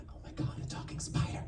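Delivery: hushed, breathless whisper; whispered amazement at a spider; awed whisper. Retold or imitated. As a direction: whisper it in disbelief.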